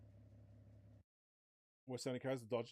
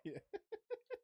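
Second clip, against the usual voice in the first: high giggle. Real, not acted. Laughing at quiet exhaust.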